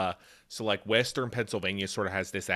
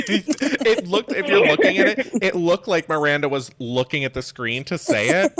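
high, loud through real laughter; laughing; 8 semitones higher, 8 dB louder than usual. Real, not acted. Co-host teases about reading the screen, laughing. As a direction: crack up while getting the words out.

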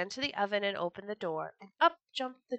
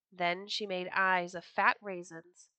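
ordinary delivery

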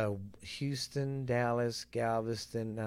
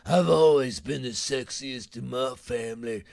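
imitating the TV woman's boast in a put-on voice; her deep voice. Host mocking a reality-show woman's voice. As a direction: mimic the woman with the voice that sounds like a bar. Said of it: "the motherfucker's voice".

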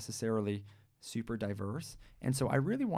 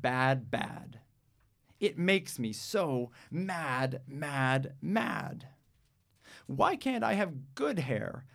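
put-on higher, slow, sulky girl's complaint; upset child; 4 semitones higher, 3 dB louder, much slower than usual. Jamila telling mom why she hates her hair, sulky and upset. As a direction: stretch out bad and mad like a frustrated kid.

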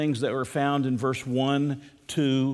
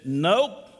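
put-on little boy's southern "nope", pitched up; southern drawl; 5 semitones higher, 4 dB louder than usual. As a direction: answer in a light southern drawl, voice rising. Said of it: "in a little southern drawl".

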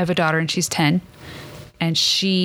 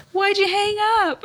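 high laughing voice; 13 semitones higher than usual. Real, not acted. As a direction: talk through laughter. Laughter heard on lines.

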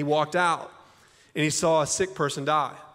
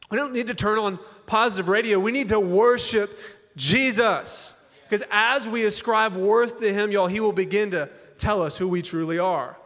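raised, driving sermon voice; forceful preaching; 5 semitones higher, 3 dB louder than usual. Acted. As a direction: push it hard like a pastor at the climax.

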